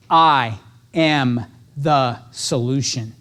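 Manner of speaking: very slow, low mantra; self affirmation. Shown as a demonstration. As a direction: speak it slowly to yourself before the call. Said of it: "first thing I say to myself".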